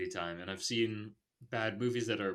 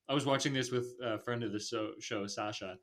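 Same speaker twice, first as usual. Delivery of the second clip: usual speaking voice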